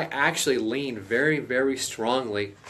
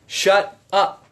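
firm, louder stern order; stern command; 7 semitones higher, 8 dB louder than usual. Acted. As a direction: say it like an order that allows no reply. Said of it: "That is what that word means".

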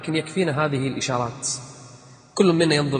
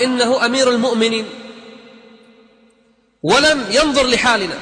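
woman complaining; 7 semitones higher, 5 dB louder than usual. Acted.

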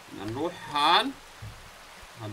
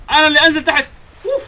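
much higher and louder, yelled; angry yell; 10 semitones higher, 11 dB louder, faster than usual. Real, not acted. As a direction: bark it out in fury. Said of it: "مش انا اللي انزل تحت".

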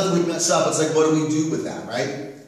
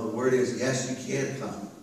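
usual speaking voice

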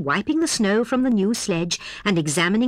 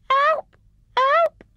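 very high, tiny cry; faint piping; 4 dB louder than usual. A narrator's reading. Hedgehog calling from inside the snowball, faint piping. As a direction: pipe it like a trapped little hedgehog. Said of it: "piped little Fuzzy Pig faintly".

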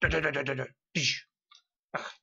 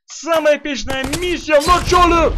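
frustrated shout; 8 semitones higher, 6 dB louder than usual. Real, not acted. Frustrated at hard level.

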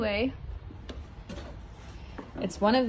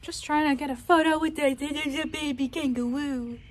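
ordinary talk

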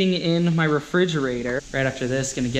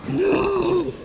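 shocked cry; 14 semitones higher than usual. Real, not acted. Missing the flight, cries out.

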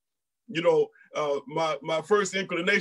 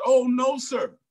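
pleading denial; 6 semitones higher than usual. Retold or imitated. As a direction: protest your innocence. Hannah protesting to the priest.

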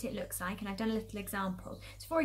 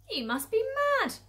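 loud, raised-pitch mockery; jeering; 13 semitones higher, 6 dB louder than usual. Villagers mocking the farmers in the crater, jeering. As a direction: jeer at them from above. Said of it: "The people jeered and laughed".

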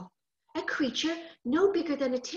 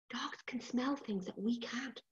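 whispering; 13 dB quieter than usual. A narrator's reading. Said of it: "whispered aside".

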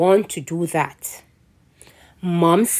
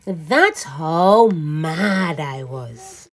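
usual speaking voice

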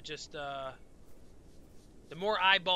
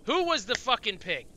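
higher, louder annoyed outburst; 6 semitones higher, 6 dB louder than usual. Real, not acted. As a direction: snap at the attacker. Attacked by a creature.

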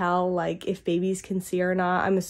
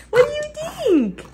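very high-pitched, cooing question. Real, not acted. Mother asks baby about first taste of apple, high baby talk.

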